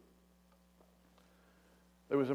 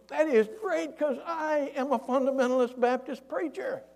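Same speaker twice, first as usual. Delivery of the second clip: high, loud acted holler; sustained long-distance shout; yelling; 12 semitones higher, 6 dB louder than usual. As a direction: call up to a man far above. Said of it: "The guy yells".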